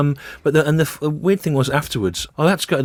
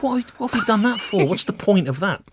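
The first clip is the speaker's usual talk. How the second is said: high, put-out "what have you done that for"; a hint of comic outrage at the kids; mock indignant; 9 semitones higher than usual. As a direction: play it baffled and offended, not scared. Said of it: "I just sort of went, I went".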